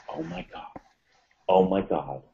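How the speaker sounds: very hushed, awestruck murmur; soft, breath-held disbelief; stunned whisper. Retold or imitated. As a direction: barely voice it, as if the heart stopped. Retelling heart stopping at wetsuit news.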